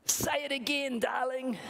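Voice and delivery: high, playful plea to his daughter; doting father joining in the game; playful dad. Acted. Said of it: "the dad said to his".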